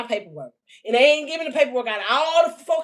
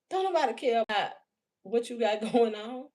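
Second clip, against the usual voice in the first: ordinary talk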